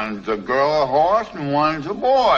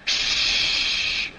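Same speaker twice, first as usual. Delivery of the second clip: hushing; 3 dB quieter than usual. Acted. Hushing before telling a secret.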